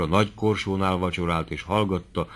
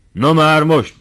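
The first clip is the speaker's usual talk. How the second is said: higher and louder, pressing on; unstoppable push; 8 semitones higher, 9 dB louder, slower than usual. Acted. Doctor pressing his argument on Tóth, loud and higher. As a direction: barrel on, nobody can stop him. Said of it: "folytatta a doktor feltartóztathatatlanul".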